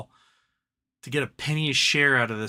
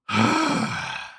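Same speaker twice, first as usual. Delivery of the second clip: long exasperated sigh; a loud sigh of frustration; frustrated sigh; 7 semitones higher, 3 dB louder than usual. Real, not acted. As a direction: let out a heavy fed-up sigh. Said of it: "So frustrating".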